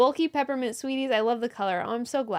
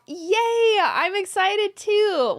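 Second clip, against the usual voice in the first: a high, loud burst of joy; excited yay; 6 semitones higher, 4 dB louder, much slower than usual. Real, not acted. Host thrilled that Cara will meet her, excited. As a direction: squeal it with delight.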